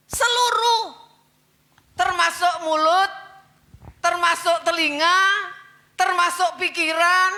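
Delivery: high, loud sermon, each word driven hard; fiery preaching. Acted. Preacher urging the church to be holy, loud fiery sermon. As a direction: preach it to the congregation with fire.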